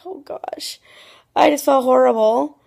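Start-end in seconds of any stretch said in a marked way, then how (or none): 0.1-0.8 soft, whispery retold groan; hushed dismay. Retelling her guilt as he was ready for bed, hushed dismay. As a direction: breathe it out almost voiceless.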